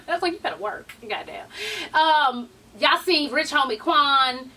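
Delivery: ordinary talk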